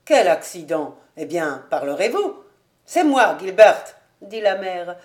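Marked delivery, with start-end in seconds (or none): 0.1-2.4 low, stern questioning; angry husband, pitched down; wrathful demand. A narrator's reading. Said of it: "lançant un regard courroucé à sa femme". 2.9-3.9 defiant mother-in-law; bold admission. A narrator's reading. Mother-in-law boldly owns up.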